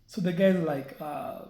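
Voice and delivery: ordinary delivery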